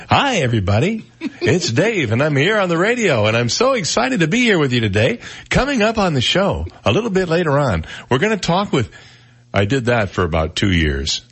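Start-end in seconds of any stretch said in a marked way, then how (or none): 0.1-8.9 higher, eager early-seventies DJ voice; bright put-on announcer, pitched well up; old high radio voice. Shown as a demonstration. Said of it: "I used to talk like this".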